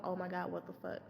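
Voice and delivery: low, quiet exasperated mutter. Retold or imitated. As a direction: grumble it under your breath. Muttering in disbelief at kid.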